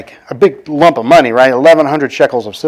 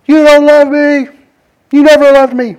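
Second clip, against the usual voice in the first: whining Delilah; 13 semitones higher, 5 dB louder than usual. Retold or imitated. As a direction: drag it out in a wounded pout.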